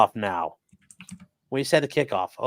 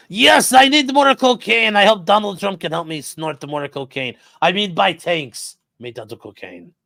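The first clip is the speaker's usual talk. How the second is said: Zelensky impression; 5 semitones higher, 4 dB louder than usual. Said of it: "President Zelensky says".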